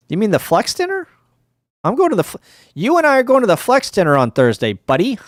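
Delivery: high, fast, playful jab; mock possessive teasing. Streamer teasing Rob on Discord, high playful jab. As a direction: lean on your buddy, half joking.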